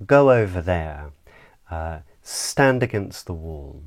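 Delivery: commands falling on "there" and "wall"; downward inflection. Shown as a demonstration. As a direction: give orders that drop at "there" and "wall". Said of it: "downward inflection".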